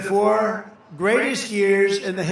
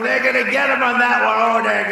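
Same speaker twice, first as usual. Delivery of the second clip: higher and louder, rousing the crowd; loud high emphatic; 4 semitones higher, 7 dB louder than usual. Real, not acted. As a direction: drive it home to the rally.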